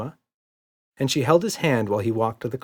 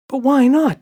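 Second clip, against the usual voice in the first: put-on little girl voice; 10 dB louder than usual. A narrator's reading. Emma voiced.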